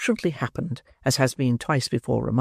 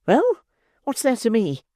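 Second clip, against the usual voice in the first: higher, sharp irritated retort; angry; 9 semitones higher than usual. Acted. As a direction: snap back impatiently. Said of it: "angrily demanded the matron".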